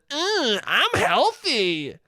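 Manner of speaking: high, slow, self-satisfied brag; smug healthy kid. Acted. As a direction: drag it out like a kid showing off. Voicing smug Steven.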